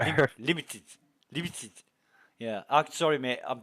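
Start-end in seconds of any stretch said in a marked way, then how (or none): 0.0-1.7 limited with clipped South African vowels; South African accent. Shown as a demonstration. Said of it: "I'm trying to do a South African accent".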